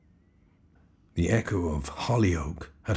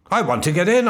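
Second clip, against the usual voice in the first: panting, much higher and louder; breathless fright; 12 semitones higher, 11 dB louder, faster than usual. Acted. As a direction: gasp it out, frantic. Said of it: "I panted, breathless with my exertions".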